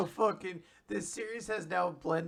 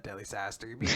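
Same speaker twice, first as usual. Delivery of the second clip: normal talking voice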